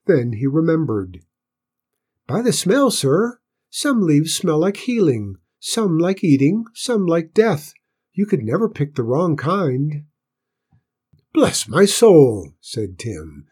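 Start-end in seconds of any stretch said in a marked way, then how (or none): 2.3-10.0 put-on high, squeaky child's voice; small boy answering respectfully, pitched high. A narrator's reading. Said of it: "in a clear but squeaky voice". 11.3-12.5 loud, drawn-out amazement; big amazed exclamation, high and slow; astonished stableman. A narrator's reading.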